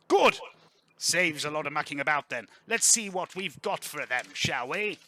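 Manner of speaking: hearty aristocrat getting down to business; boisterous lord, pleased. Acted. Game master voices brisk Laurent.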